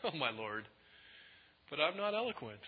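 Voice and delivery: softly offhand mock excuse; flippant. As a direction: toss it off quietly, mildly disrespectful. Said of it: "kind of flippant and disrespectful".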